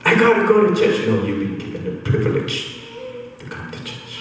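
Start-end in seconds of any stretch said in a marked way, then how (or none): 0.0-1.1 high-pitched reluctant moan; mock complaining. Preacher mimicking a reluctant churchgoer, mock complaining. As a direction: imitate someone dragging themselves to a duty.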